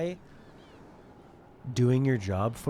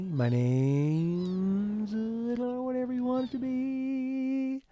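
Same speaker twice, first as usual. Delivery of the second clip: swooning reply; 12 semitones higher, much slower than usual. Acted. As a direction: voice climbing steadily into a high squeal. Jokey answer as game character.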